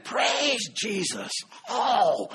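high, slow, swooping praise; excited praise. Retold or imitated. Pastor mimics Ruby getting excited during songs, high and slow. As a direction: let the joy lift the voice up high. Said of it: "She gets excited".

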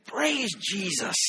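high, slow drawn-out praise; imitating Ruby's praise. Pastor imitates Ruby calling out in church, high and drawn out. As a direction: mimic an elderly woman's fervent praise. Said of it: "Ruby is constantly saying".

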